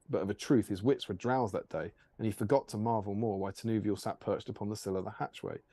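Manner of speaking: plain reading voice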